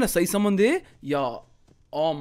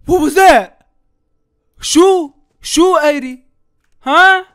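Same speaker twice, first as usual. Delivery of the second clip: loud, higher alarmed questions; alarmed shouting; 4 semitones higher, 10 dB louder than usual. Real, not acted. Gamer startled by a noise in the house, shouting questions. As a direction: react loud to a sudden noise.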